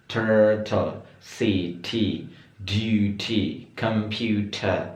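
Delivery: "city", "duty", "computer" with a clear, crisp T; crisp British T. Shown as a demonstration. Teacher demonstrating British T to students, crisp. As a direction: sound every middle T sharply. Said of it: "pengucapan T itu jelas ya".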